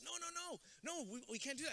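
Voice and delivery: wife protesting. Retold or imitated. Wife refuses, voiced by him.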